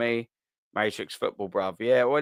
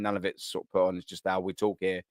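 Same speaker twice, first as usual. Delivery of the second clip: ordinary talk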